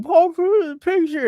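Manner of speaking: high, pleading cry to dad; frantic plea. Retold or imitated. Son crying to dad on the phone, high frantic plea. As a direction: squeal it out like a desperate superstitious fan. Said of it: "I'm like".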